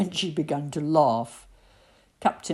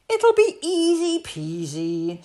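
much higher, cocky cheerful captain voice; breezy captain; 12 semitones higher, 5 dB louder than usual. A narrator's reading. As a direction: toss it off like a proud pirate who's sure of himself. Captain Pike boasts cheerfully.

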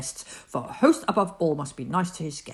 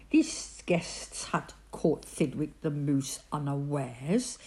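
ordinary delivery, nothing marked

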